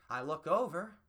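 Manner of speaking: normal talking voice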